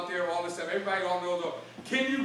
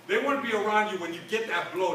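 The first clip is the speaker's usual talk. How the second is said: ordinary talk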